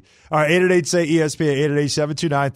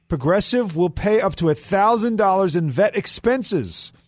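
brisk sponsor read, raised pitch; 3 semitones higher than usual. Acted. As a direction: read it like a radio spot. Host reads sponsor read.

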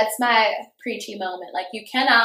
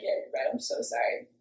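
meek apology; 9 dB quieter than usual. Retold or imitated. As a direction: give in quietly, acting out an apology. Retold giving in after fight.

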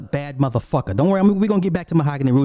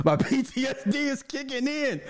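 much higher, softer, laughing sing-song; 12 semitones higher, 7 dB quieter than usual. Real, not acted. Joking to viewers about breakup trauma, laughing sing-song. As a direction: laugh through the words like a joke about your own trauma. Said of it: "I'm traumatized".

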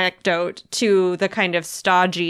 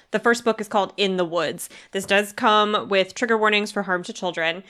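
ordinary talk, nothing marked

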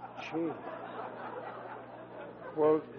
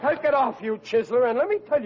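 angry outburst; 6 semitones higher, 4 dB louder than usual. Acted. Angry at uncle taking suit.

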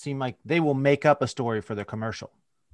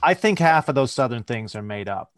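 ordinary delivery

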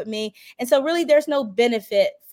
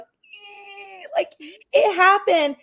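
choked-up cry; 7 semitones higher, much slower than usual. Retold or imitated. Silent emotional hand grab.

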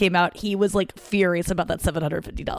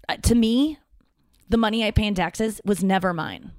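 usual speaking voice

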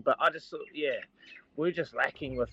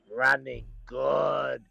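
slow race call; 3 dB louder, much slower than usual. Retold or imitated. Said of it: "race call retold".

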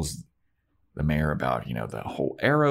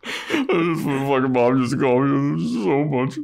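laughing; 6 semitones higher, 3 dB louder than usual. Real, not acted. Laughing at the line.

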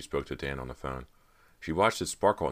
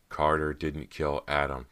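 usual speaking voice

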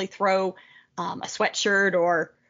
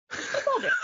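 laughing through it, pitched up; 13 semitones higher than usual. Real, not acted. Joining the group joke while laughing. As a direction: giggle the words out.

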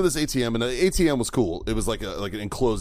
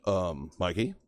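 soft, low, tentative retelling of a friend; hushed, hesitant; 5 semitones lower, 7 dB quieter, slower than usual. Retold or imitated. Stunned friend to the stabbed man, hushed and hesitant. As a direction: ask it gingerly, voice dropped.

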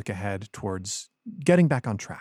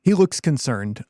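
ordinary talk

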